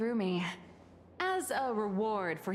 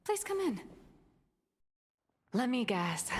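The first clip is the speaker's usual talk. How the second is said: usual speaking voice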